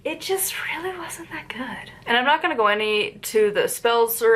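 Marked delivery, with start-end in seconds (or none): 0.0-1.9 breathy confiding whisper; whispered aside. Real, not acted. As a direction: drop to a secret whisper. Whispered aside about the book.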